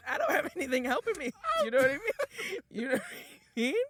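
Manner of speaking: high laughing complaint. Real, not acted. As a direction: laugh through the words.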